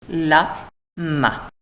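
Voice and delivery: "La, ma" as two separate beats; syllable by syllable. Shown as a demonstration. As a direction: pause between "La" and "ma". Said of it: "deux syllabes".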